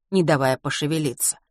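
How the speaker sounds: plain reading voice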